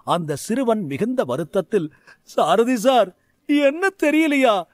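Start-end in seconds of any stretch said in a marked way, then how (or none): 2.2-4.6 put-on high, halting child's voice; a tearful boy stuttering to his teacher; stammering. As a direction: stumble over the words, upset. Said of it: "திக்கி திக்கி பேசினான்".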